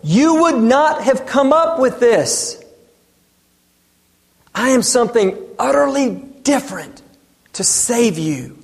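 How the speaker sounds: lightly voiced Jesus, pleading; earnest plea. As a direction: lean in, sincere and deliberate. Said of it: "as if to say".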